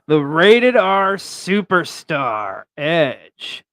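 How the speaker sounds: slow announcer drawl; wrestling introduction; ring announcer. Acted. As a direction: announce him like at ringside.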